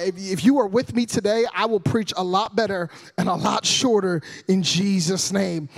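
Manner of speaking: usual speaking voice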